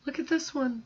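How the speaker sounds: imitating her own excited surprise; delighted surprise. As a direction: act out finding a treasure in the plates. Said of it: "It's like".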